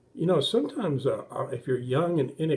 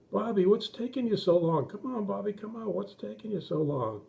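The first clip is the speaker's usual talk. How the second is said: higher, mock-impatient call through the door; siblings calling out; 7 semitones higher than usual.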